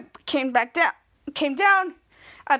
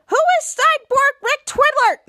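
slower, louder and much higher, fed up; insistent demand; 11 semitones higher, 6 dB louder than usual. Acted. Shadow repeating the question after the team ignored her, loud and slow. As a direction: shout the question at them, drawn out.

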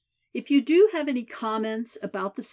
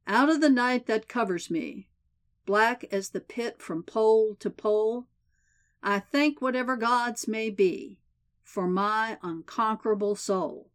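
solemn poem reading, a little louder; poem recitation; 2 semitones higher, 4 dB louder than usual. A narrator's reading. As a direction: recite it like a warden at an execution. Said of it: "The warden cleared his throat and began".

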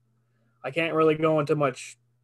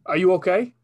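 louder, urgent cop questions; urgent detective; 5 semitones higher, 7 dB louder than usual. Acted. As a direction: press the kid, urgent.

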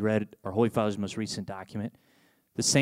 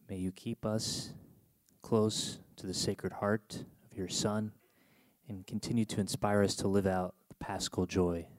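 closing prayer; 4 semitones lower, 5 dB quieter than usual. Acted. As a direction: pray it softly over the people. Priest leads closing prayer.

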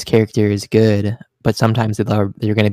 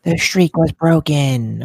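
slow, higher-pitched proclamation; hammed-up dramatic announcement; mock announcer; 5 semitones higher, much slower than usual. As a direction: draw it out like a solemn announcement. Mock announcing the Undertaker's lost streak.

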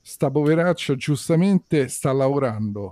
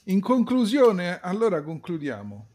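far from mic; 5 semitones higher, 4 dB quieter than usual. Real, not acted. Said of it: "Avvicinati al microfono, se no non si sente".

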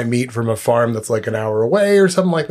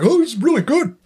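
high, silly Bert voice, much higher; 13 semitones higher than usual. Retold or imitated. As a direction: squeak out a mock Bert delight. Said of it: "he'd be like".

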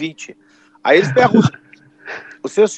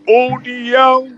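higher, slower, louder call of União; stretched, louder repeat of União; driver calling again louder; 6 semitones higher, 3 dB louder, much slower than usual. Retold or imitated. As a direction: call the stop harder the second time. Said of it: "Ele falou novamente".

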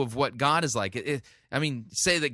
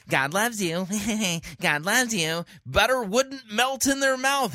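mocking syrupy preacher; 7 semitones higher than usual. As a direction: parody a sweet-talking preacher with a laugh. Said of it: "he would say".

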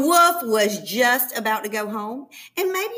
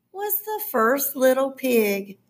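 ordinary delivery

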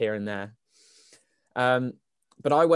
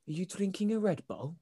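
softer, higher put-on Welsh voice; Welsh accent; 6 semitones higher, 9 dB quieter than usual. Stranger asks about the drink, put-on Welsh accent. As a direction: imitate a nosy Welshman, pitched up. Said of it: "he was Welsh".

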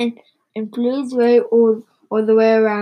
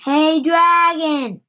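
slow, higher storybook call; drawn-out shout; 4 semitones higher, 4 dB louder, slower than usual. A narrator's reading. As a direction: call it out, stretching each word. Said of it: "She shouted".

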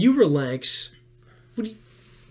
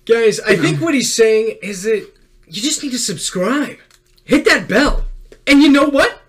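loud, high promo hype; hype outro; 5 semitones higher, 6 dB louder than usual. Acted. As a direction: pump up the audience. Hyped call to subscribe.

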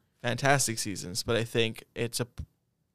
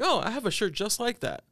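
surprised realization; 10 semitones higher than usual. Retold or imitated. Realizing in photo album.

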